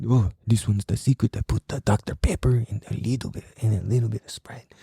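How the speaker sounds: put-on low Filipino uncle accent, drifting Mexican; badly faked Filipino accent, trailing to a whisper; botched Filipino accent. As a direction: share a secret in a wobbly Filipino accent. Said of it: "that kinda got a Mexican accent on it".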